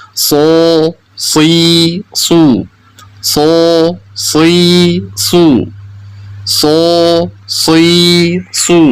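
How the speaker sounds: drawn-out emphatic Arabic sad in "So, si, su"; thick sod sound. Shown as a demonstration. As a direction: thicken the S and vowels in "So, si, su". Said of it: "agar menghasilkan suara yang lebih tebal".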